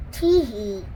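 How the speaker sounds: a sly little tee-hee to himself; chortling. A narrator's reading. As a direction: chuckle over a secret plan. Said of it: "he chortled to himself".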